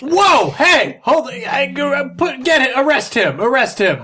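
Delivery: high, flustered shouted orders; stammering high-pitched alarm; alarmed shouting. Acted. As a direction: bark panicked commands over a brawl. Trooper reacts to the brawl.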